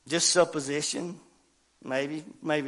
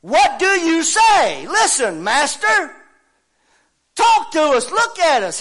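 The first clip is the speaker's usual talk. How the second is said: high, drawn-out preacher's shout; loud, high-pitched quoted challenge; shouted demand; 13 semitones higher, 15 dB louder, slower than usual. Acted. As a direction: cry it out loud at full force. Preacher voices challengers in scene.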